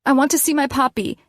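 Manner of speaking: high, fast, insistent demand; angry demand. A narrator's reading. Daughter demanding her puppy from mom, fast and high.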